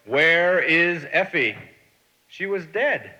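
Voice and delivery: slow, loud bellow; bellowing God. A narrator's reading. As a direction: boom it out like God calling. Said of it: "bellowing above the general noise".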